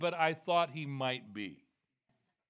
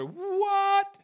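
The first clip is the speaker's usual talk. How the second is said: shocked what; 10 dB louder than usual. Acted. Shocked listeners' reaction.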